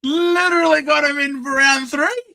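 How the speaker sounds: loud, high, thrilled brag; excited. Real, not acted. Streamer bragging to viewers about his trade, loud and high.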